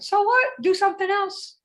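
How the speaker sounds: high, offhand shrug; dismissive. Acted. Speaker brushing off a half-hearted effort, high dismissive shrug. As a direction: toss it off like it doesn't matter.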